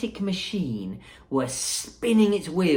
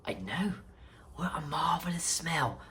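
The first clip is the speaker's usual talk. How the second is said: put-on softer, hushed old grandpa; a touch of a grandpa's whisper; 10 dB quieter than usual. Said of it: "answered Grandpa Joe".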